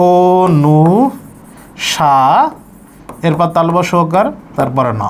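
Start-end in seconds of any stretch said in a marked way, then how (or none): 0.1-2.2 অনু stretched slowly, then সা alone as "shaa"; slow, held syllables. Shown as a demonstration. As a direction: sound out o, nu, shaa with pauses. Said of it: "তাহলে এটা হবে".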